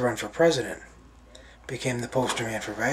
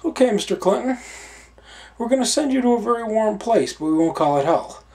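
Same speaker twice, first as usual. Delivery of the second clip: higher, louder mock-stern judge; a hint of Saint Peter; 6 semitones higher, 5 dB louder than usual. Saint Peter sentencing Clinton in a lightly voiced judge tone. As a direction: hand down the sentence with dry irony, speeding up at the end.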